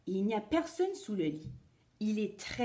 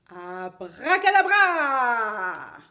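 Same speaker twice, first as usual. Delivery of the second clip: loud, slow, drawn-out magic word; magic incantation; 10 dB louder, much slower than usual. Acted. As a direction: boom it out like a stage wizard.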